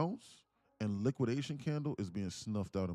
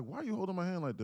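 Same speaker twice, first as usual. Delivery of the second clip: higher imitation of her question; suspicious girlfriend; 11 semitones higher, 4 dB louder than usual. Girlfriend with her hand held, higher wary question. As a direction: play her wary and confused.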